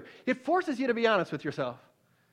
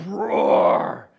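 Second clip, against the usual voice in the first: loud, low lion roar; big guttural roar; 7 semitones lower, 7 dB louder than usual. Acted. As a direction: roar from the throat like a huge father lion. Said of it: "you hear this big guttural".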